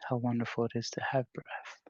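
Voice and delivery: unhurried, spacious meditation voice with long pauses; guided meditation. Real, not acted. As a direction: gently guide the group into stillness. Leads a meditation.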